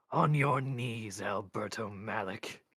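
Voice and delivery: slow, loud, otherworldly command. A narrator's reading. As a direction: order him down, drawing out each word. Mort commands at sword point.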